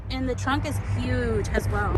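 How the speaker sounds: ordinary delivery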